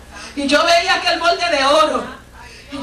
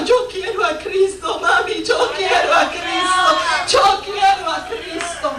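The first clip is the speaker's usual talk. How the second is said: slow, higher tearful cry-out, retold; crying son; 4 semitones higher, slower than usual. Son on the floor to his mother, crying and slow. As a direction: son sobbing out his surrender, slowly and higher. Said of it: "llorando, llorando, hasta que cayó en el piso".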